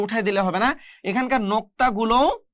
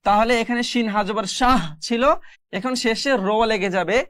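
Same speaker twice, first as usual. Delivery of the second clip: normal talking voice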